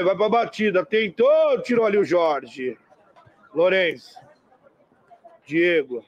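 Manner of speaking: play-by-play commentary. Acted. Live match commentary.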